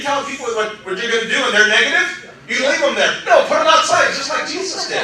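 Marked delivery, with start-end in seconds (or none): 3.2-4.1 high, loud insistence; emphatic command. Acted. Preacher urging the congregation to put naysayers out, loud. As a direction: throw them out with force. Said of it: "that's our problem sometimes".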